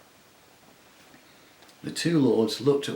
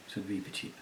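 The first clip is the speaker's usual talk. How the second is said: ordinary delivery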